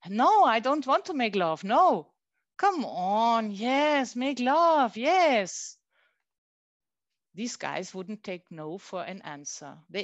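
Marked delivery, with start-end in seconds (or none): 0.1-2.1 loud, higher refusal. Acted. Refusing a man's advances. 2.6-5.8 pushy pleading. Acted. Man pressing a woman.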